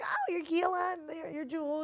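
lightly voiced high-pitched mom; a hint of high social greeting; acquaintance's greeting. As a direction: mildly imitate a mother recognizing someone. Girl's mother greeting them, acted.

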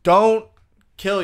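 sudden loud "Don't". Acted. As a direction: blurt it out loud.

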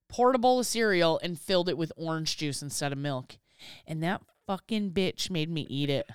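ordinary talk

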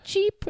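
high, squeaky "cheep, cheep" chick chirp; squeaky mock cheep. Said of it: "it's just like".

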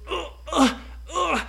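high grunting strain; straining grunts. Acted. Brady pushing up giant cheese, grunting. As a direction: grunt under the weight.

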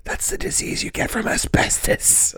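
hushed, voiceless explanation; whispered aside. Pretend.

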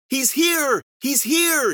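very high, loud announcement; excited cry. Acted. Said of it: "she cried".

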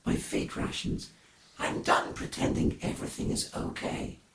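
hushed, breathy alien confession; quiet confession. Acted. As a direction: confess it under your breath.

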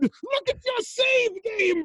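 high, slow villain yell; ominous shout. Acted. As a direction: bellow the threat slowly. Villain taunts about save.

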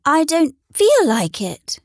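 shy toy rabbit turning down an invitation; Velveteen Rabbit's voice. A narrator's reading. Toy rabbit declines to play, slow and reluctant. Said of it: "said the rabbit".

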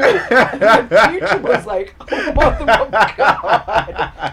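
laughing. Real, not acted. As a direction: laugh while telling. Laughing retelling.

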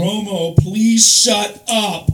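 loud, slow yell at the TV. Retold or imitated. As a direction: drag out please shut up. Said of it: "I yell".

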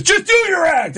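high mock-angry yell; angry heckler. Angry guy won't take it down a notch, high angry yell. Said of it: "sounds violently angry".

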